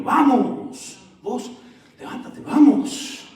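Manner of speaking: high, loud imitated Salvadoran accent. Salvadoran churchgoer urging people to get up, imitated Salvadoran accent. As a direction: mimic a Salvadoran woman's rousing shout. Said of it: "lo decía con acento salvadoreño".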